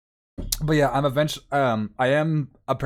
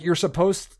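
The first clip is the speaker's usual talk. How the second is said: normal talking voice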